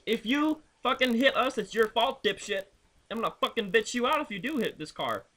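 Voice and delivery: yelling back at the tailgating Silverado driver; retold angry yell. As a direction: tell the tailgater off.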